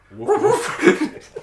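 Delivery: high laughing barks; laughing woof. Acted. Friend barks at the big dog joke, laughing. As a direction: bark like a dog through laughter.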